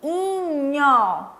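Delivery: "inho" isolated and stretched out, falling; stressed rhyme ending. Shown as a demonstration. Teacher showing children what the rhymes end in, "inho" drawn out. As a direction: hold the ending "inho" long so the rhyme stands out. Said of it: "terminam em".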